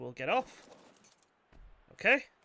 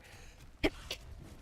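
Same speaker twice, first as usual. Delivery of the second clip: big sneeze; 4 dB quieter than usual. Real, not acted. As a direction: let a big sneeze burst out. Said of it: "That was a big sneeze".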